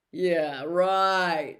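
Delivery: Malfoy smirking at Snape. Acted. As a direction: sneer it off.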